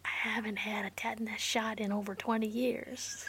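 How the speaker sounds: whispered close into the mic. Real, not acted. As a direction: lean in and whisper it like a secret. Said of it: "I'm gonna whisper this into the mic".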